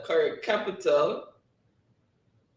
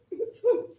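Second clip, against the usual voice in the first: a sharp high laugh; high squeal of laughter. Real, not acted. Host reading chat, sudden high laugh. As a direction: squeal with amusement.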